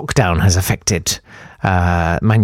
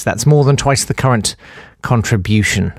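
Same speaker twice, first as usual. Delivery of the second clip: ordinary delivery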